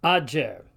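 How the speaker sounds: ager as "ad-jair". Shown as a demonstration. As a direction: say ager with the dj of adjust. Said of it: "more like the D J".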